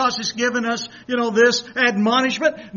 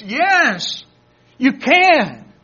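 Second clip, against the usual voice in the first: emphatic; 3 semitones higher, 6 dB louder, much slower than usual. Acted. Preacher declaring scripture promise.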